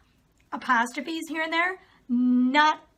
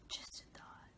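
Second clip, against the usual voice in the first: dry sarcastic whisper under the breath; whispered aside; 24 dB quieter, slower than usual. Real, not acted. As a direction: murmur it as a snide aside.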